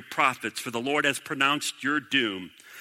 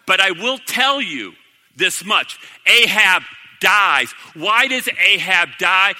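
slow, loud, emphatic preacher delivery; forceful preaching; 5 semitones higher, 6 dB louder than usual. Real, not acted. Preacher warns the congregation about refusing the truth, forcefully. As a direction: drive it home to the congregation, loud and deliberate.

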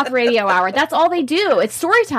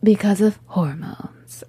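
slow conspiratorial whisper; slower than usual. Acted. Co-host blaming drinking on hormones, whispered. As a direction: breathe the punchline slowly.

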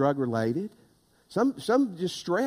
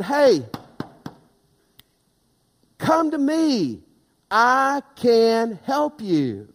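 loud, driving preacher's call to come; preaching at full force; 4 semitones higher, 4 dB louder than usual. Acted. Pastor urging the tired congregation to come to Jesus, loud and driving. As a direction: press it home to the congregation, louder and louder.

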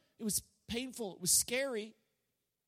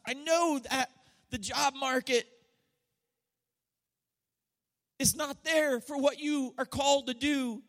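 louder, higher preacher's cry, each "I know" driven home; preaching at full force; 5 semitones higher, 3 dB louder than usual. Acted. Preacher voicing God to his hurting people, loud and rising. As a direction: proclaim it to the whole room like a revival preacher.

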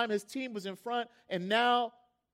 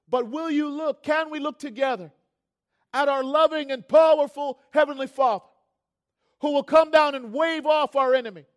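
loud, high rallying appeal; rally the congregation with full conviction; impassioned preacher at full force; fervent preaching; 6 semitones higher, 11 dB louder than usual. Real, not acted.

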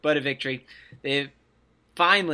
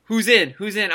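higher, louder eager asking; eager O'Korn; 7 semitones higher, 6 dB louder than usual. Retold or imitated. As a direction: call out eagerly to the coach. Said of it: "O'Korn's like".

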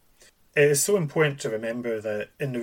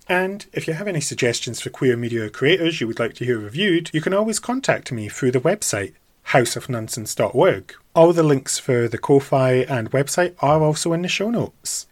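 podcast outro; 3 semitones higher, 8 dB louder than usual. Acted. Podcast outro links plug.

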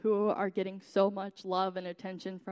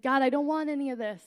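pleading, higher, louder and fast; a broken cry to God, rushed and raised; crying out in despair; 6 semitones higher, 7 dB louder, faster than usual. Retold or imitated. [name] on her couch begging God, in tearful despair. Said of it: "as I sat on my couch crying".

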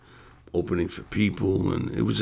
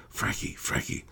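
whispered attention-getter, imitating his wife; urgent whisper; 7 dB quieter than usual. Wife discreetly taps him, acted.

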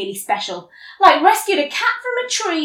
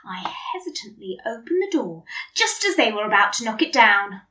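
ordinary delivery

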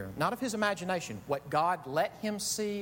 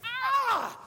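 a much higher, loud, raw "Ah!" scream; ecstatic scream; 6 dB louder than usual. Retold or imitated. Fan erupts alone as the band stops, with an uncontrolled high scream. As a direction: let it burst out like joy going supernova. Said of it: "and he just went".

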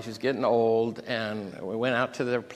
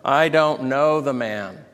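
loud, slow, emphatic denial; forceful oath, mildly acted; swearing denial; 2 semitones higher, 8 dB louder than usual. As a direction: swear it firmly, word by word. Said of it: "he swore to them".

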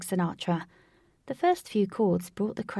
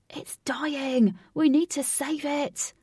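urgent; 7 semitones higher, 4 dB louder, slower than usual. Acted. Lynx panics at emptying party.

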